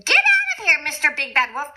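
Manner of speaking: rude pig shouting. Retold or imitated. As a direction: bark him away like a rude little pig. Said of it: "that rude little porkster answered".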